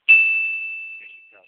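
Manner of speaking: very high, slow squeal; falsetto squeal. Pretend. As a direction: stretch it out in falsetto. Mock shiny sound effect.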